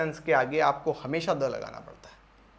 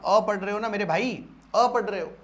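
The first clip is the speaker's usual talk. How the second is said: usual speaking voice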